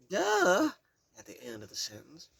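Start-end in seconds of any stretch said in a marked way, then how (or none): 0.1-0.7 high, loud sarcastic duh; mock duh. Implied duh after her answer, loud mocking tone. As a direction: make it sound obvious. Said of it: "She said it like there should have been a".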